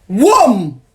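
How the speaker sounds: loud, booming "wham" for a slap; slap sound. Retold or imitated. Storyteller imitating her ex's hot slap, booming. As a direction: punch out the noise of a blow.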